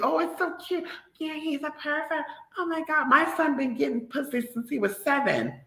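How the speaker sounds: high, soft gushing mimic of a proud parent; mock doting parent. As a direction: coo it like a clueless proud mom.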